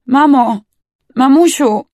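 high, very loud cry; desperate calling. A narrator's reading. Bleeding girl calls her mother, desperate cry.

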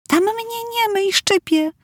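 put-on very high, soft, whiny child voice; a small girl protesting it stings; little girl complaining. A narrator's reading. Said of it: "powiedziałam". (marudząca mała dziewczynka)

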